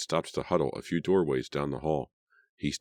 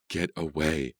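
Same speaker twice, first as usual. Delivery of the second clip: usual speaking voice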